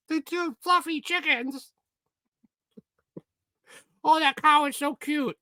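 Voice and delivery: put-on high, cooing little-girl voice. Mimicking his little sister cooing over farm animals. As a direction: gush like a small child over animals. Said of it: "my sister was, like, eight".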